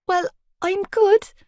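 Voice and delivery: high, slow, strained reply. A narrator's reading. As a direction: force it out awkwardly. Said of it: "tone a little strained".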